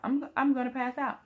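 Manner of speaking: rising panicked cry of fear; frantic mock alarm; panicky. Panicked quote during labour.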